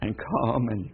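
put-on soft, pleading child; a lightly voiced sorry little girl; child begging mother for forgiveness, softly; sorrowful little voice. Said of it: "a sorrowful little voice said".

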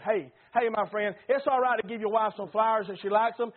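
ordinary delivery

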